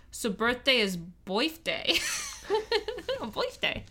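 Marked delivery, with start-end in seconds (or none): 1.2-2.0 boifday with an oi vowel; birthday as boifday; Yat boifday. Shown as a demonstration. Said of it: "pronounced with an oi sound". 3.2-3.8 boifday with an oi vowel; birthday as boifday; Yat boifday. Shown as a demonstration. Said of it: "pronounced with an oi sound".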